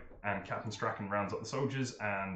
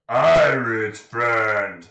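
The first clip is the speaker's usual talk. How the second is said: big, slow ogre speech; slow loud ogre voice; 15 dB louder, much slower than usual. Acted. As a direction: boom it out like a simple ogre. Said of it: "Crump steps in and says".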